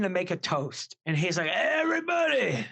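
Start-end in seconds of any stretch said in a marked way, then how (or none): 1.5-2.6 retold shout. Drunk friend calling for a toast over blasting music, mock shout. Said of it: "music is blasting at this point".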